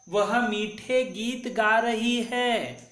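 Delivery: sing-song teacher recitation, stretched out; slow emphatic recitation. Shown as a demonstration. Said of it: "फिर से हम उच्चारण करेंगे".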